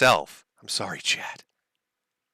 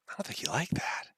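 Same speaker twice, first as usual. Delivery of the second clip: whisper; 14 dB quieter than usual. Real, not acted. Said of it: "aside to the chat".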